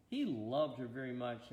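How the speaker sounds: ordinary delivery, nothing marked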